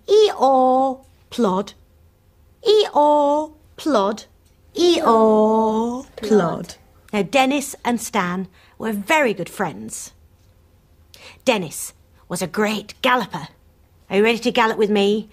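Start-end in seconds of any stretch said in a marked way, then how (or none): 0.1-6.7 loud bray, then a heavy plod; old donkey plodding. A narrator's reading. Storyteller voicing slow old Stan the donkey. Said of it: "He would go". 14.1-15.3 fast, bright call to join in; eager invitation. Real, not acted. Storyteller rallying the children to gallop. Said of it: "asks children to join".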